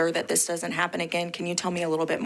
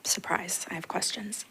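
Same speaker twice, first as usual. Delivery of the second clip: breathy playful whisper aside; 3 semitones higher, 6 dB quieter than usual. Real, not acted. Board member teasingly announcing her questions, whispered. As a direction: slip in a hushed teasing aside.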